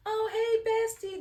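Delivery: squealed high greeting to a bestie; a child's high, delighted hello; girl squealing hey to her bestie; excited greeting. Retold or imitated. Daughter runs in greeting.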